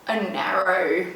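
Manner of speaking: ordinary delivery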